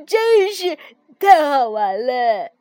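loud, slow, gasping through laughs; breathless with laughter. A narrator's reading. The frog, worn out from the snowball fight, breathless laughing. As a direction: speak while still catching breath from laughing. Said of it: "上气不接下气地说".

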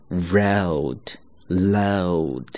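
road then load, very slowly, r versus l; slow contrast of r and l; contrasting pair. Shown as a demonstration. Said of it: "它是不一样的啊". (慢速对比road和load)

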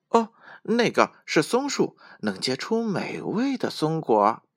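high, bright little squirrel; happy squirrel voice. A narrator's reading. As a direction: chirp it happily like a squirrel. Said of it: "小松鼠开心地说".

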